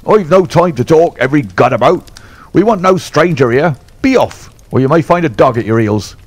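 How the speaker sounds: a bully ordering strangers off his yard; fierce old trainer snarling at a stranger; surly trainer. Acted. Trainer threatens Holmes.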